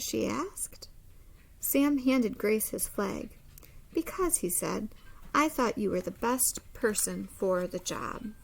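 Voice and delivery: expressive storybook read-aloud; lively picture-book narration; reading to children. Acted. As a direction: read it like story time for a class.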